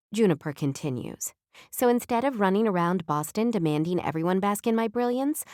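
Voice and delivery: plain reading voice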